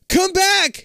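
loud, high call Come back!; yell. Acted. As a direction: shout after the car. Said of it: "he yells".

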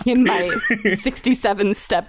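slow gloating villain. Pretend. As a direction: lay out the master plan slowly.

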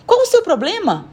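high, sharp question; exasperated challenge. Acted. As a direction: confront him, fed up. Woman confronts boy at door. (pergunta irritada e aguda)

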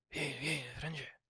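a hint of a mother whispering to her son; mother calling softly. Imitating the mother calling Franz.

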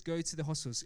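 ordinary delivery

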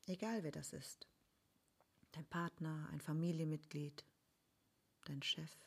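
ruhige, leise Meditationsstimme mit langen Pausen; guided meditation. Acted. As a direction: sanft durch die Meditation führen. Leitet eine Vergebungsmeditation an. (geführte Meditation, leise und ruhig)

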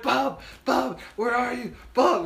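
high calling voice. Retold or imitated. Searching friends calling for Bub in the dark woods, high calls. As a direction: call his name through the trees.

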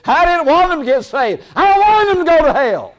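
high, loud indignant complaint; angry Jonah. Acted. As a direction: rail at God like a sulking prophet. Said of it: "Basically said".

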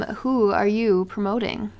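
ordinary delivery

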